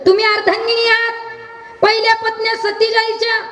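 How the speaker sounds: high, ringing kirtan delivery; full preaching voice. Acted. Preacher challenges the wife, in full sermon voice. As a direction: declaim it to the crowd.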